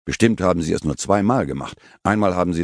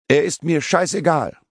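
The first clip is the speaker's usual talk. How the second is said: screaming; 10 semitones higher than usual. Acted. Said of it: "Und sie schreit".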